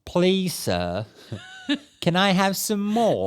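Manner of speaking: put-on British accent, rising high at the plea; exaggerated British accent, a pleading urchin; British impression. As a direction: beg like a Dickens orphan in a British accent. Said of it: "Let me try and impersonate a British person".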